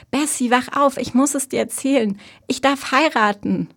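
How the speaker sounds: high, loud, urgent announcement; bursting to tell; excited. A narrator's reading. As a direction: wake your sister with thrilling news. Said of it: "Marys Stimme war aufgeregt".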